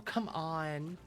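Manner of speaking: ordinary delivery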